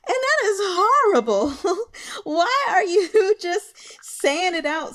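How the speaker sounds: high, loud scandalized cry through laughter; shocked outburst. Retold or imitated. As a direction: scold him, half laughing in disbelief. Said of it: "I was like".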